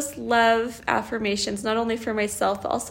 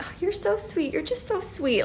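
put-on high, soft gushing to a child; doting mother; 7 semitones higher, 4 dB quieter than usual. Mother praising her little girl, doting. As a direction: coo sweet praise at your little girl.

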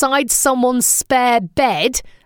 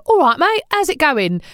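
higher-pitched cheery Cockney greeting; Cockney accent; 6 semitones higher than usual. Shown as a demonstration. Demonstrating husband's accent theory, Cockney accent. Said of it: "just a Cockney accent".

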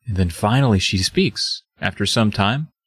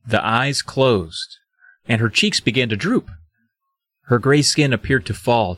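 normal talking voice

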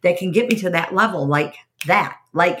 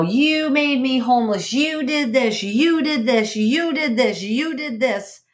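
raised, higher-pitched blaming voice, hammering each you; accusing relative; 4 semitones higher, slower than usual. Retold or imitated. Relative on the phone blaming her for being homeless, higher accusing tone. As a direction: throw the blame at her over and over.

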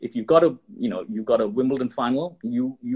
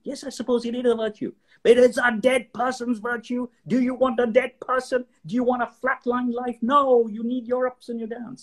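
much higher, animated preaching voice; big-personality shaman; 11 semitones higher than usual. Retold or imitated. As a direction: lift it up and scold them grandly. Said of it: "big, big personality. He said".

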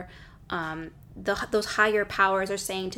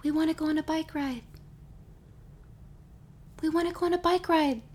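little inner voice; 6 semitones higher, 6 dB quieter than usual. Retold or imitated. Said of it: "I'll hear a little whisper saying".

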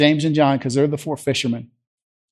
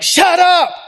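much higher, loud command; shouted rebuke; 7 dB louder than usual. Acted. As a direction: shout down the storm. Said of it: "he rebuked the wind and the sea".

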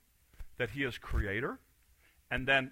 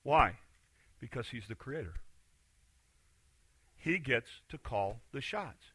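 ordinary talk

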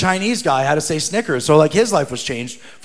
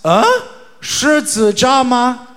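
slow, higher-pitched puzzled question; puzzled response; 6 semitones higher, slower than usual. Retold or imitated. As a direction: imitate a baffled shopkeeper checking what she heard. Shopkeeper's puzzled reply acted out.

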